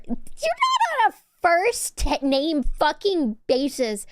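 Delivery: high, drawn-out indignant screech; mock outrage. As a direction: screech at chat, stretching the words. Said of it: "I fucking scream at them".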